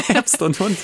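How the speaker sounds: high, giggly correction; real amused laughter, pitch way up; laughing. Real, not acted. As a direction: say it while laughing. Laughing after mixing up the topic.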